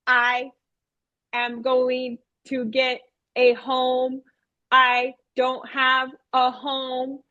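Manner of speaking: slow robot monotone with gaps between words. Retold or imitated. Teacher as robot puppet confessing it is homeless, robotic monotone. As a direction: state it like a robot, very evenly. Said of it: "I talked like that".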